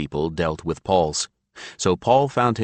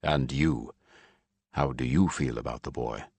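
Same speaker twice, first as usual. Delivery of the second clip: lower, softer husband's voice; lower, soft fatherly voice; Paul's voice; 5 semitones lower, 6 dB quieter than usual. A narrator's reading. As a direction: voice the quiet blind father, gently asking. Narrator voices Paul.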